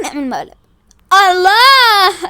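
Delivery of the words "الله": long, loud drawn-out exclamation; thrilled, loud whoop; delighted cheer. Acted. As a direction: stretch it out with delight. Thrilled by prank idea.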